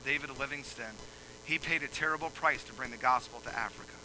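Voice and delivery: ordinary talk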